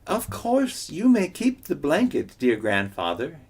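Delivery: high, overly polite trickster voice; butter up the rock, pitched high; sweetly polite coyote; cordial Coyote. Acted. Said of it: "in his most cordial tone".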